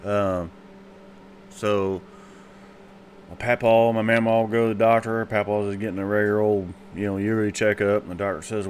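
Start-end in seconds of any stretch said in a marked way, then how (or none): none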